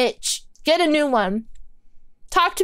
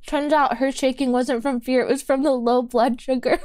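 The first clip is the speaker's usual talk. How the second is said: ordinary talk, nothing marked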